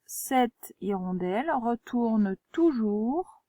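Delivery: very slow, word by word; teacher writing the sentence on the board; slow writing-along reading. Shown as a demonstration. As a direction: say it as you write it. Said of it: "je complète ici".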